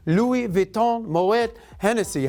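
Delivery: slow, put-on French accent on the brand names; mock French accent. Host showing off his French on the luxury brand, slow mock French accent. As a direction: savour Louis Vuitton Moet Hennessy in a hammed-up French accent. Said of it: "How's my French accent?".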